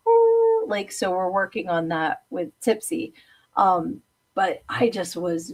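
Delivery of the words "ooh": much higher, louder dog howl "ooh"; imitating a dog's howl. Imitating Muffy singing on command with a high howl. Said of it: "and she goes".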